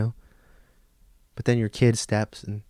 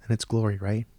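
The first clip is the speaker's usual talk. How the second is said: ordinary talk, nothing marked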